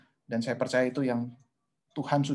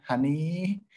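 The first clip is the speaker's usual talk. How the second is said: imitated sweet-talk; 4 semitones higher than usual. Imitating sweet dating-days pet name.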